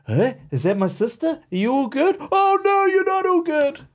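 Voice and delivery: high, eerie video game character voice; Resident Evil voice acting. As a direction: pitch way up like a game cutscene. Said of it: "They should hire you for the voice acting in Resident Evil games".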